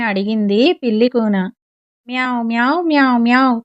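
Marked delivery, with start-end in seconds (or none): 2.1-3.6 mock cat meows, lightly voiced; big cat teaching the kitten, gentle mock meows; meowing. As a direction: meow like a mother cat teaching. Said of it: "అని బోధించింది పెద్ద పిల్లి".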